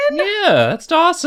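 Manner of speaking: excited. Real, not acted. Excited confirmation.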